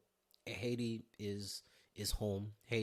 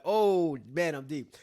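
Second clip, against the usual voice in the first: mock panic; 5 semitones higher, 11 dB louder, slower than usual. Sinking in the pool.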